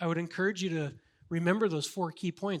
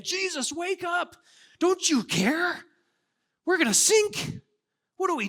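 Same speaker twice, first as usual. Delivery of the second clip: high frantic cries, much higher and louder; panicked disciples; 12 semitones higher, 5 dB louder than usual. Acted. Disciples wake Jesus in the storm, panicked high shouts. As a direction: shout in terror as the boat sinks.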